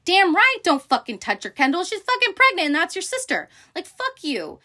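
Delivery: high, loud, outraged outburst; indignant telling-off. Retold or imitated. Siding with the pregnant sister against Kendall, loud outrage. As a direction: scold in full indignation, voice shooting up. Echoes the brother's angry defence.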